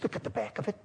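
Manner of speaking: very soft imitated wife; hushed prompt. Wife urging husband to turn the postcard over, hushed. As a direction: murmur it far below normal volume.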